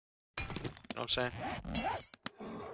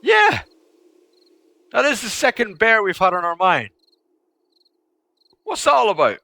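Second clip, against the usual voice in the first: higher, louder keyed-up exclaiming; much louder higher excited chatter about the bear; excited shouting; 12 semitones higher, 12 dB louder than usual. Real, not acted. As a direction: blurt it out, hyped.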